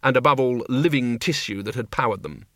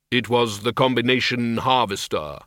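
grim reaper voice. Acted. Death answers.

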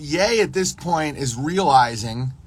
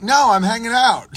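higher, louder mock defiance; defiant shout; 8 semitones higher, 5 dB louder than usual. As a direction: dig in and yell you're staying. Defiant patron refusing to leave.

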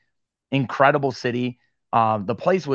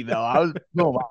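normal talking voice

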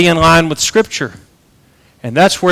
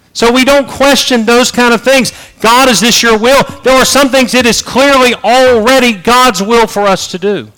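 higher, raised preaching voice; preaching at full force; 8 semitones higher, 4 dB louder than usual. Acted. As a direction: drive it home with force. Preacher rising again.

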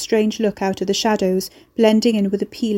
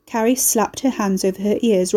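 ordinary delivery

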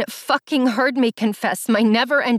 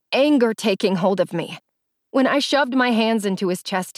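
usual speaking voice

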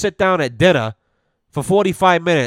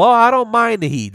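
higher, smug New Englander brushing off "the heat"; mock New Englander; 8 semitones higher, 3 dB louder than usual. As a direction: toss off "Oh, I don't mind the heat" like a cocky New Englander. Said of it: "somebody from New England says".

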